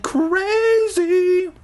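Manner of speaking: drawn-out, very high squeal on the word; comic high-pitched drawl; exaggerated crazy. A narrator's reading. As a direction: stretch it out way up high. Exaggerated end of narrated sentence.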